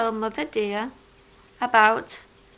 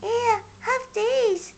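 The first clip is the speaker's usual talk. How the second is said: high, slow, gentle offer; kind hedgehog; 10 semitones higher, much slower than usual. A narrator's reading. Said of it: "says Little Hedgehog".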